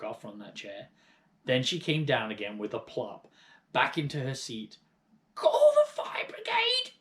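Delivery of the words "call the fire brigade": very high, loud, slow panicked shout; sudden shout. A narrator's reading. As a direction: bellow for help like a frantic old woman. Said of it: "she shouted suddenly".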